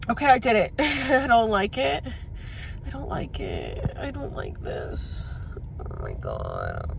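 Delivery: drawn-out tearful complaint; real tearful regret. Real, not acted. Admitting to the camera she dislikes the trip, tearful. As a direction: start loud, then let it sink into a slow, teary moan.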